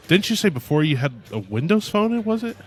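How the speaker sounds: ordinary talk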